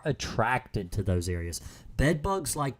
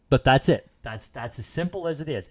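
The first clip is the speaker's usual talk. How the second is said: ordinary talk, nothing marked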